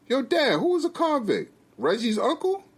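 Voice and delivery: high-pitched, puzzled son; surprised, high-voiced questioning; curious son.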